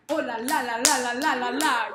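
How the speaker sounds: "la, la, la" in a loud, even bouncing beat; rhythmic. Shown as a demonstration. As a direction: chant "Oh, la, la" rhythmically, pulsing each "la". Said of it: "there has to be a rhythm".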